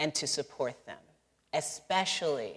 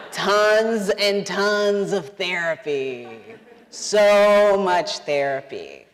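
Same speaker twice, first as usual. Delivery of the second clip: "Tons and tons" loud, high and hugely drawn out; comic emphasis; 5 semitones higher, 9 dB louder, much slower than usual. Real, not acted. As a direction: stretch "Tons" and "So" very long and loud. Comic emphatic answer to own question.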